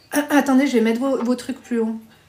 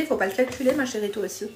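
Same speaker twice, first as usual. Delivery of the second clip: ordinary talk, nothing marked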